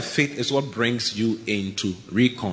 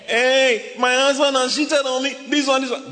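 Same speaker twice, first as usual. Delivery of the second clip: mock outraged wife; complaining wife; 10 semitones higher than usual. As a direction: whine like a wronged wife. Wife voiced complaining about husband.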